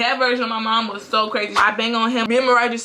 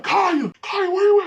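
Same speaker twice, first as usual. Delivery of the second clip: high-pitched mock calling; calling out, imitated; 10 semitones higher, 3 dB louder than usual. Dad calling for his daughter, imitated holler. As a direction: act out searching for someone by name. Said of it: "I hear him start calling for me".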